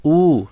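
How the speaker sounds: ऊ held long on its own; teacher modelling a long ऊ; long vowel demo. Shown as a demonstration. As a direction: draw out the vowel ऊ. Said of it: "बड़ा उच्चारण है। उसी तरह".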